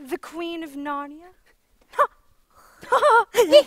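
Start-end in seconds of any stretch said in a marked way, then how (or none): 2.8-3.4 scornful laugh. Acted.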